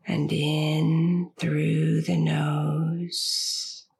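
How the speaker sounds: slow, soothing meditation voice with long pauses; unhurried, stretched words, easing the listener toward sleep; guided sleep meditation. Acted. Host guiding listeners with insomnia into sleep, slow guided meditation.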